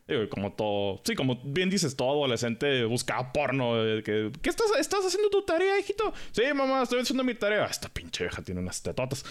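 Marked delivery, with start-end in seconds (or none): 4.4-6.1 much higher, quick nosy mother; mom voice. Retold or imitated. Mom checking on her teen. 6.3-7.6 put-on higher, overly innocent teen; innocent kid voice. Teen lying to his mom. 7.6-9.2 lower, softer mutter. Retold or imitated.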